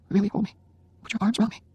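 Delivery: dying wife asking husband to hold her; tender plea. A narrator's reading. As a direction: ask to be held. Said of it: "Jenny insists tenderly".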